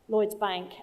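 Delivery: normal talking voice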